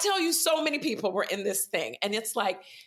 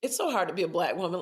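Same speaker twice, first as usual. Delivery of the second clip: ordinary talk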